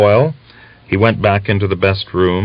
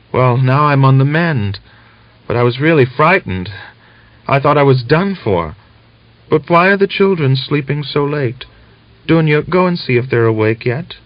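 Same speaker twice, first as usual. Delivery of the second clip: higher voice of a sick wife on the mend; relieved but frail, pitched up; weak young woman; 7 semitones higher than usual. A narrator's reading. Sick Natalia speaks.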